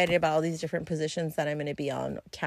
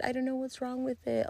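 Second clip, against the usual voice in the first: lightly voiced, whiny and high; mock sad; 6 semitones higher, 8 dB quieter, faster than usual. To the customer, a whiny mock-sad voice. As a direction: play up a fake sad girl. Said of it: "And I'm like".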